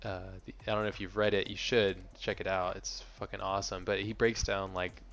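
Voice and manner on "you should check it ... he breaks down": ordinary delivery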